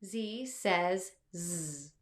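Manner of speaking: first Z named zee, last Z buzzed zzz; name the first Z, buzz the last Z; letter and sound. Shown as a demonstration. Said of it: "let's say the magic words".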